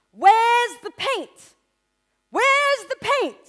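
very high, slow, loud shriek of where's the paint; mocking teacher. Art teacher berating the student over paint, high screech. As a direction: demand it like a scornful teacher.

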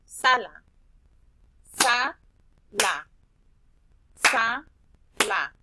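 "Sala" split loud into SA-LA; clapped syllables. Shown as a demonstration. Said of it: "vamos a hacerlo con la palabra sala". ("Sala" separado en sílabas con palmadas)

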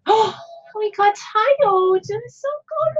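high, slow sleepy whine; high whiny tired voice. Acted. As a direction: whine like an exhausted little creature. Voices tired characters in game.

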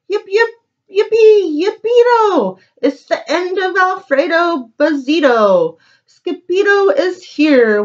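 high, bouncy cheering chant; gleeful cheer. Acted. The Chihuahua celebrates the hero's arrival, high excited cheer. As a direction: yip it out like a jubilant little dog.

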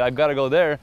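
ordinary talk

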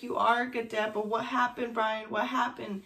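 ordinary delivery

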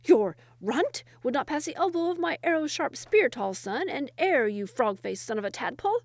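angry ranting. A narrator's reading. First wazir insulting his rival's son, angry. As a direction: hurl insults with relish. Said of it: "screamed the first wazir".

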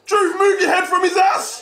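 slow, high, loud yell with laughter in it; drawn-out shout. Real, not acted. Streamer urging Truth to move, slow laughing shout. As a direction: stretch the yell out, cracking up. Yells it again, slower.

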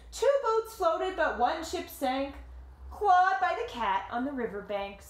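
story narration. A narrator's reading. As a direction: tell it like a picture book to kids. Reading the picture book aloud.

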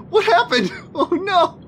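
sudden high yelp of a question; startled surprise. Real, not acted. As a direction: jump up in pitch, caught off guard. Butterfly suddenly explodes again.

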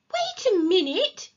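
put-on high, loud child's objection; indignant challenge. A narrator's reading. Peter stopping his brother, indignant. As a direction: pull him up short.

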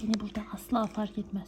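unvoiced hiding whisper; whispering while hiding. Real, not acted. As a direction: whisper it, sure of the spot.

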